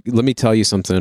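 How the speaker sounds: ordinary talk, nothing marked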